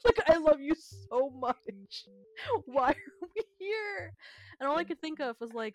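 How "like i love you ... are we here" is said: slow, pained, high complaint; drawn-out plea. Retold or imitated. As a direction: stretch it out in mock agony. Exasperated plea.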